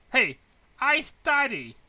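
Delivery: high, loud indignant cry; indignant protest. A narrator's reading. Student protesting to teacher she does study, high and loud. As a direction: protest as if wrongly accused.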